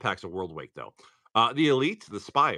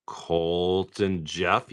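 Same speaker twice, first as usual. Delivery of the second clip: drawn-out name; 5 semitones lower, much slower than usual. Acted.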